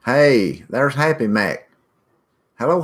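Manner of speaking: ordinary delivery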